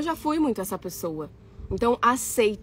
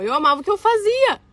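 high, loud declaration; raised pitch, ringing out with passion; emphatic gushing; 11 semitones higher, 7 dB louder than usual. Real, not acted. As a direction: proclaim it with big enthusiasm. Insisting she loved her work.

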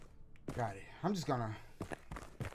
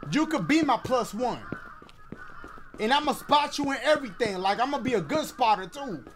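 flirty shout-out; 5 semitones higher, 4 dB louder than usual. Acted. Calling out to a game character.

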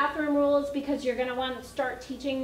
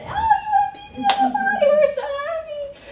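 very high, loud gushing praise; shower the child with joy; 9 dB louder than usual. Retold or imitated. Family gushing at the toddler after her first pee.

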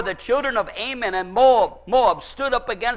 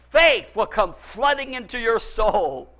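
normal talking voice

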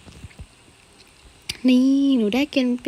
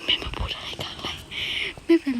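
unvoiced whisper, half to herself; hushed mortified whisper; embarrassed whisper; 6 semitones higher, 6 dB quieter than usual. Real, not acted. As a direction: hiss it under your breath in embarrassment. Embarrassed aside about mother.

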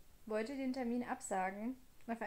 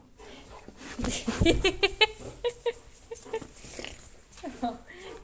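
high breathy laughter; 8 semitones higher, 8 dB louder than usual. Real, not acted. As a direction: giggle without voice. Laughing at dog.

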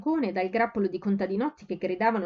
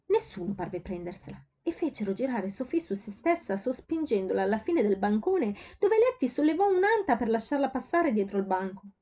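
ordinary delivery

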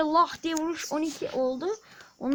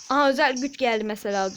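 ordinary talk

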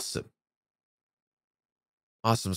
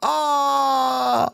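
pained cry; 5 semitones higher than usual. Real, not acted. Emotional game ending.

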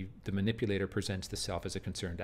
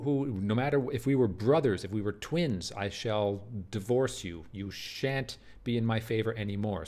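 furious oath; 2 semitones higher, 4 dB louder than usual. Acted. Othello to the brawlers, vowing wrath loudly. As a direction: thunder it as a wrathful general.

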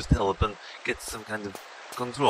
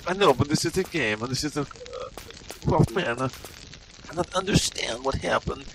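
put-on broken English in a heavy Russian-style accent; bewildered Russian-accented gamer; mock Eastern European accent; 6 semitones higher, 3 dB quieter than usual.

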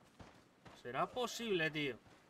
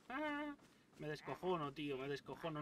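usual speaking voice

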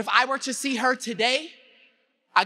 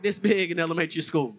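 normal talking voice

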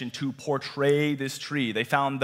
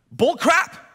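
loud, high-pitched mock protest; mock indignant outburst; 13 semitones higher, 7 dB louder than usual. Preacher voices painter rejecting realism, mock indignant outburst. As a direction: burst out as the fed-up painter.

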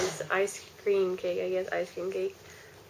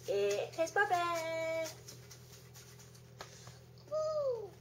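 high, drawn-out celebratory squeal; high cheer that stretches the words out slowly; birthday cheer; 9 semitones higher, 7 dB quieter, much slower than usual. Real, not acted. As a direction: whoop it up for the birthday.

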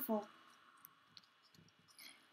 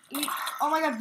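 normal talking voice

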